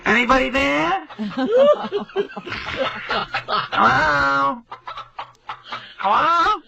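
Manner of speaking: high, calling mock yell; mock yelling hello. Mother yelling into the answering machine, high mock call. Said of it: "she's yelling into the answering machine".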